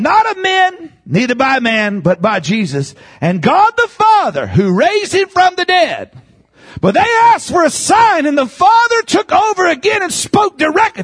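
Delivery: high, driving sermon cry; full-force preaching. Real, not acted. Preacher citing scripture to the congregation, high and forceful. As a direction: proclaim the scripture at revival pitch.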